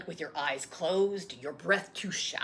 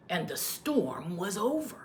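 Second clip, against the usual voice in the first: plain reading voice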